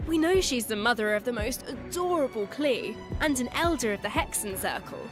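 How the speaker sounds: ordinary talk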